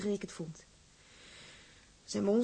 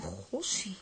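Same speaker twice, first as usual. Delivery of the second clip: whispered gossie; 9 dB quieter than usual. Acted. Amazed at ring gift.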